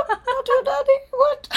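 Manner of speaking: squeaky high teasing voice; goofy squeal of disbelief; squeaky mock voice. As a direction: squeak it up like a cartoon.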